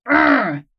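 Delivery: short acted growl of frustration; tense mock growl. Host imitating the coiled anger in their belly, mock growl. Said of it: "a coiled snake in my belly".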